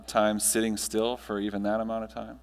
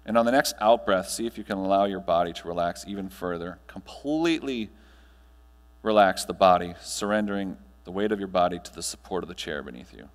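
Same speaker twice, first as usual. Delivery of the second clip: guided meditation; 3 semitones lower than usual. Acted. Speaker guiding the audience through breathing, calm meditation voice. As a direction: ease the room gently into stillness.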